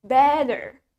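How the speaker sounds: better with a D sound; American R at the end of better; American pronunciation. Shown as a demonstration. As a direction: model "better" as "bedder". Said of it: "Let's try it with me".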